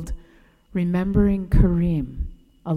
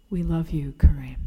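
tearful whisper; 4 semitones lower than usual. Real, not acted. Narrator writing the farewell card, tearful whisper. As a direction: breathe the words like a card you can barely finish. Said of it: "and wept as I wrote".

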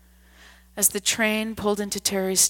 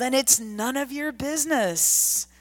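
put-on higher, rough drunken yell; 6 semitones higher than usual. A narrator's reading. Drunk man brushes off the old man, higher drunken yell. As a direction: snap it off, none of his business. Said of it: "the man yelled".

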